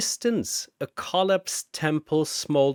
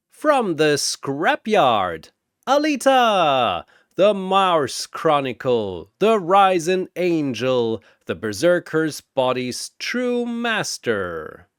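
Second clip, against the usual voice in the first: booming announcer; 4 semitones higher, 3 dB louder than usual. Acted. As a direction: boom it across the arena. Announcer introduces next fighter.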